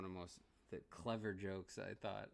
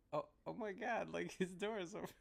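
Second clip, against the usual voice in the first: higher, a sudden gasp of discovery; mock realization; 10 semitones higher than usual. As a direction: play the dawning shock of getting the joke. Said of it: "you're just like".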